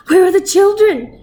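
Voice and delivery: high, loud frantic cry; frantic father crying out. A narrator's reading. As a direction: panic for the children. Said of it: "he cried frantically".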